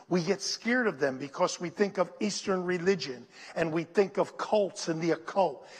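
ordinary delivery

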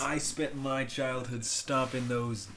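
very slow, drawn-out mock contempt; said like a slur. Podcast host parodying an actor's childhood grudge, mock slur. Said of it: "he said it like a slur".

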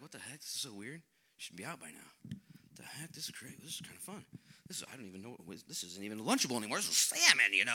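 lightly voiced disbelieving disciple; amazed disciples.

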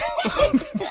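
sudden high-pitched burst of laughter; squealing laugh. Real, not acted. Laughing at sister's memory.

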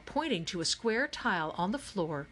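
plain reading voice

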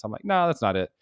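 ordinary talk

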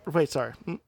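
normal talking voice

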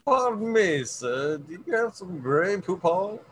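lightly put-on high, courteous voice; mock-polite gentleman. Joking about worms asking for Grey Poupon, mock-polite. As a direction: play a politely inquiring mustard-ad gentleman.

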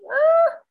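short, very high cry; retold shriek. Mother reacting to the cat fur, retold shriek. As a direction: let out a high, loud yelp.

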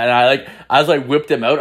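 normal talking voice

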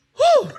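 loud, high celebratory whoop. Real, not acted. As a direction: let out a joyful woo. Celebrating his promotion.

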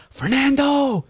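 high, drawn-out imitated call; calling after someone. Cousin calling after him.

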